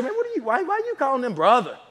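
high, mock-baffled questioning; imitating someone confused; puzzled outsider. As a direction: stumble into a high incredulous question.

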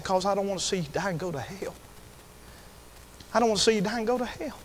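hushed, quick pleading; soft plea. Retold or imitated. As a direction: plead softly at a sickbed. Retold plea to dying stepfather.

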